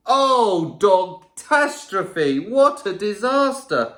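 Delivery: ordinary delivery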